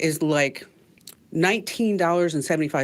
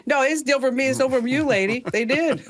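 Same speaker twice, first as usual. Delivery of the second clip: put-on Vietnamese accent; 7 semitones higher than usual. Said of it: "reprising the dry cleaner".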